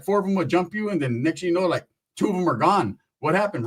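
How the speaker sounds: ordinary talk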